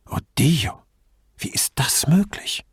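hushed, rising disbelief; half-whispered astonishment at what you see; mild dismay. A narrator's reading. Grifoni staring at her shoes: hushed, mild dismay.

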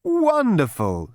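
slow, high gushing praise; delighted psychiatrist. A narrator's reading. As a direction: savour the word. Psychiatrist pleased.

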